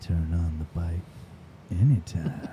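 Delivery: very low, soft, drawn-out read; hushed, low slogan with a long pause. Shown as a demonstration. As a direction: drop deep and take your time. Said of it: "Can you say it slower?".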